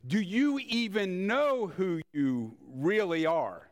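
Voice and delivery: ordinary delivery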